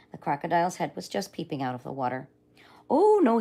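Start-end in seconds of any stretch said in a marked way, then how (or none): 2.9-3.4 surprised polite refusal. Acted. Father politely refusing crocodile.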